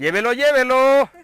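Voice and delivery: loud, high vendor's sales yell; Mexico City street vendor cry. Shown as a demonstration. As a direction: hawk it like a chilango street vendor. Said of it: "Pero versión chilanga".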